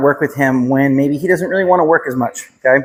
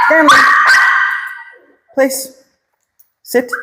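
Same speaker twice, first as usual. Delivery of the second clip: high-pitched dog command; 14 semitones higher, slower than usual. Shown as a demonstration. Trainer showing the owners the command again. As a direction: call him up high to get his attention. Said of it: "Let me show you again".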